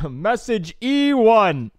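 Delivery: high, slow, loud recorded voice; automated phone message. Retold or imitated. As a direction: spell it out like a machine. Imitating a phone error message.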